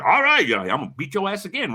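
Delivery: high-pitched mock tough-guy threat; acting tough. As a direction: imitate a guy talking all tough. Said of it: "All tough and shit".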